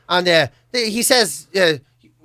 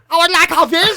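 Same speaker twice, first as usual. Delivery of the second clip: mock Chinese accent; 9 semitones higher than usual. Refusing comedian mimics the Chinglish visa request, mock Chinese accent. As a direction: exaggerate a put-on Chinese English accent. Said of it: "put on their accent".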